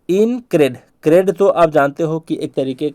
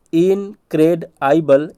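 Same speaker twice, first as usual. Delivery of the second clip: slow, split इनक्रेडाइबल as in-cred-aibal; drawn-out spelling read; broken into parts; much slower than usual. Shown as a demonstration. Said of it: "इसी तरीके से करा रहा हूँ".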